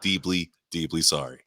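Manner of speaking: low, slow, hushed apology; solemn public apology. Real, not acted. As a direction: apologise gravely on air, pausing before "deeply". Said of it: "I will say it publicly".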